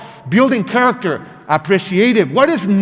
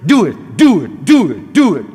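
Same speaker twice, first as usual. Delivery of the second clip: louder, barked repeated orders; strict parent commanding; 4 dB louder than usual. Retold or imitated. Speaker imitates her strict parents ordering her, louder bark. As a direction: hammer the same order like a drill sergeant parent.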